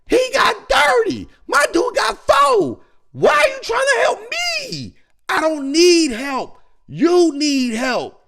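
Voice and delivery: furious high shouting at a teammate; mic rant. Pretend. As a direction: yell it down the mic, fed up. Imagined yelling on mic.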